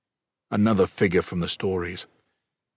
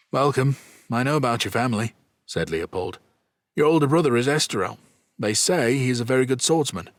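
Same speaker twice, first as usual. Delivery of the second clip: put-on brisk, higher, faster young nobleman; young duke voice; 6 semitones higher, 3 dB louder, faster than usual. A narrator's reading. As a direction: rattle it off like an eager young duke. Duke Leopold greets a guest.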